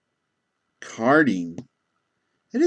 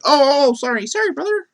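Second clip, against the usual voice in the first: high, loud startled apology; startled big guy apologizing; flustered apology; 3 dB louder than usual. Retold or imitated. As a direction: blurt out a sheepish sorry, much higher. Apologetic guy in mask scene.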